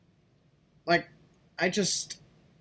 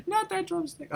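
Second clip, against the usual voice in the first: laughing, high; 6 dB quieter than usual. Real, not acted. Repeats through laughter.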